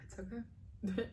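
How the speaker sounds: softly, through lingering tears; real quiet tearfulness; teary. Real, not acted. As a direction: let the emotion catch, then settle. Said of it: "let me clear out my lingering tears".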